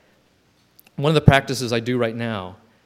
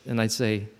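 usual speaking voice

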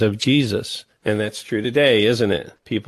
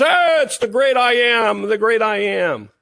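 mock awed chorus; 11 semitones higher than usual. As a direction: parody the crowd's reverence in a high, swooping voice. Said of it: "And everybody says".

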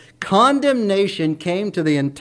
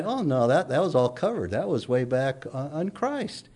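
God brushing it off, much softer; lightly voiced God, softly dismissing it; a hint of a kindly God waving it away; gentle God; 7 dB quieter than usual. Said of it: "God's gonna say".

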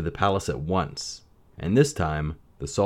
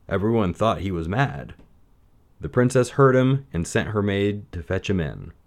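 plain reading voice

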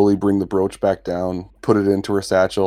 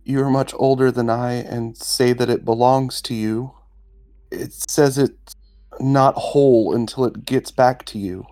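higher-pitched, earnest character voice; adventurer offering a gift; 4 semitones higher than usual. Acted. Adventurer offers the stone to the dragon, higher, measured. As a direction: offer it slowly in a raised voice.

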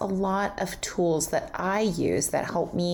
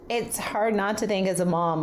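ordinary delivery

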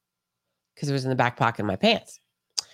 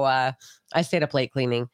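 usual speaking voice

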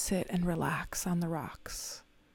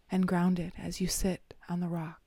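ordinary delivery

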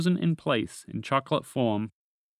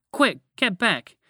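urgent warning; 7 semitones higher, 4 dB louder, slower than usual. Acted. Dragon warns frogs of danger.

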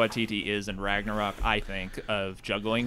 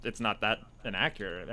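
normal talking voice

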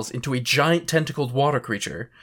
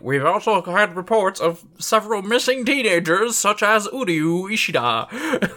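news anchor voice; 7 semitones higher than usual. Acted. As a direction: announce it like a TV anchor. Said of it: "the news anchor is also like".

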